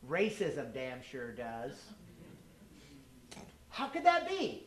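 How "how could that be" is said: high, incredulous question; mock puzzlement. Speaker voices an objection to the racism claim, mock baffled. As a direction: ask it as a baffled objector, pitched way up.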